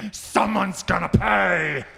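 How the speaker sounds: menacing threat. Acted. Rebound effect, frantic cook threatens.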